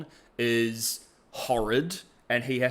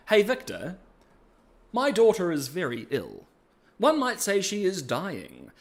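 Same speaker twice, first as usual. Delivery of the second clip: lightly acted, higher-pitched plea; Singed; 6 semitones higher than usual. Singed asking Victor to help his daughter, lightly voiced plea. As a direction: play a hesitant request, rising higher at the end. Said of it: "Singed is like".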